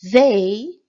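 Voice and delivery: "They" announced loud and bright with a rise; loud reveal. Shown as a demonstration. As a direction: present "They" like a big reveal. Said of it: "O plural de it".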